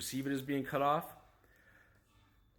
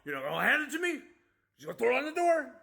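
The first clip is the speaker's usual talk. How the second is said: higher, louder sneering rant at the driver; hammed-up furious jab; mocking his angry self; 7 semitones higher, 4 dB louder, faster than usual. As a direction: parody of his own angry outburst. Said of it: "'Cause I get so mad".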